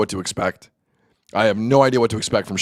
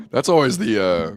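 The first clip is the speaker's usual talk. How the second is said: ordinary talk, nothing marked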